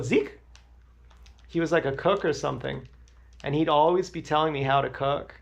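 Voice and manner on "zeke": mock friend calling. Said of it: "He'd go".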